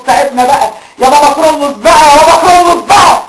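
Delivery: desperate prayer. Acted. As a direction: beg heaven, building to a shout. Desperate prayer building to shout.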